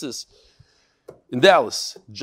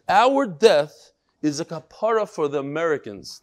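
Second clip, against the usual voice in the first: usual speaking voice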